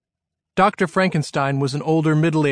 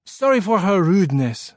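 put-on higher-pitched French-accented man; 5 semitones higher than usual. A narrator's reading. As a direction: introduce yourself in a French accent. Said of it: "in a French-sounding accent".

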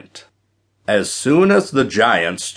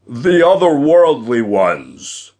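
loud, raised giant's voice; taunting giant; 4 semitones higher, 5 dB louder than usual. A narrator's reading. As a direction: boom it out like a giant.

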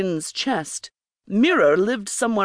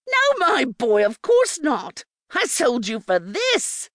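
put-on sweet old hag, much higher, slowing at the end; warmly; 8 semitones higher than usual. Beatrice telling Quinn she sold him, with mock warmth. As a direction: fake sweetness from a greedy crone. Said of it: "Beatrice smiled warmly".

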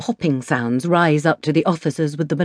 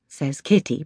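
usual speaking voice

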